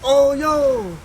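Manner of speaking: loud, high whoop of triumph; excited cheer. Real, not acted. Gamer cheering his new level, loud and high.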